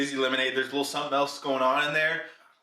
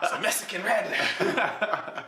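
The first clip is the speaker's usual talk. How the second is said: very slow hissing drawl through a laugh; drawn-out s like a snake; 5 semitones higher, much slower than usual. Pretend. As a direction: stretch the s sounds like a snake. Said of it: "Texan guy describing a Mexican snake".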